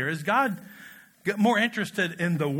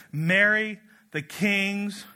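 ordinary talk, nothing marked

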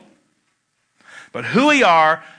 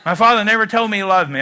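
ordinary talk, nothing marked

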